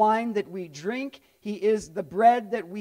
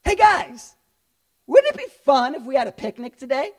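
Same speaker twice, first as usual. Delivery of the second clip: breezy Jesus; 5 dB louder than usual. Retold or imitated. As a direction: pitch a cheeky picnic to the crowd. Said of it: "and he goes".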